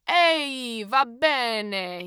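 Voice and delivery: loud, friendly Italian greeting; hearty call as if to an old friend; big jovial Italian hello. A narrator's reading. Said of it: "he said jovially".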